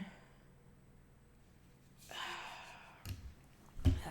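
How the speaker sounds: long, drawn-out exhale; long sigh. Acted. Boss to unexpected visitor on the calendar, long sigh. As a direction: let out a long, breathy sigh. Sighs after checking the calendar.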